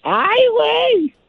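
high, slow drawn-out "Ay, güey"; put-on voice. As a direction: stretch it out in a silly high voice. Recurring put-on catchphrase voice.

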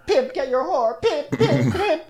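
very high, squeaky insults; hammed-up high heckling; mock name-calling. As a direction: chant it in a silly high pitch. Acted name-calling rant.

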